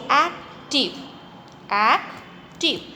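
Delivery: ac-tive spoken slowly, syllable by syllable; slow syllable split. Shown as a demonstration. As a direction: break "active" into its two syllables slowly. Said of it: "उसको तोड़ के हम बोल रहे हैं".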